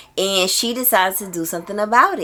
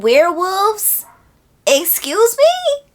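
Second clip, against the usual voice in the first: delighted squeal; 10 semitones higher, slower than usual. Real, not acted.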